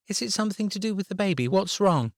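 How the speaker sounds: put-on high, fast child's voice, anxious; a little girl rushing her worried questions; worried young girl. A narrator's reading. Abby voiced by narrator.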